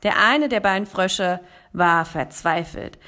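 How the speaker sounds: usual speaking voice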